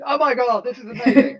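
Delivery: high, loud gushing with a laugh; amazed. Retold or imitated. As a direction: rave like you've just discovered magic. Mock amazement at Zoom.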